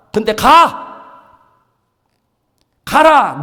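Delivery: high, loud, booming divine command; commanding God. Acted. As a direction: send him off with a thundering order. Preacher voices God commanding. (높고 크게 명령하시는 하나님의 음성)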